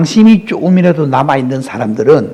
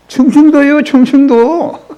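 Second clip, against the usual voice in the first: higher, slowing on the repeat; gleeful punchline; 8 semitones higher, slower than usual. Real, not acted. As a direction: land the joke with glee. Punchline about Chungcheong province. (신나게 높여 말하기)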